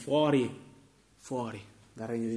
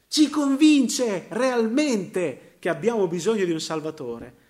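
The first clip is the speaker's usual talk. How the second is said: slow emphatic cry; 7 semitones higher, 8 dB louder, slower than usual. Real, not acted. Preacher stresses conviction slowly.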